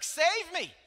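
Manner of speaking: very high, loud imitation of a child; mock panicked scream. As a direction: yell for rescue like a panicking kid. Retells son's panicked cry for help.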